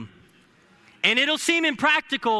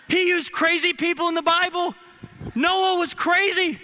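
higher, driving sermon with shouted peaks; preaching at full force; 5 semitones higher than usual. Acted. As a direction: build the congregation up, raising pitch. Preaching climax, rising to shouts.

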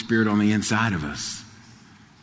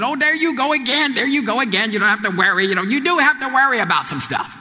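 higher, mock-exasperated mother; mother brushing off her son, higher and teasing; mother's scoffing; 10 semitones higher than usual. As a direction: play the doubting mama teasing her son. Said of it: "And she said".

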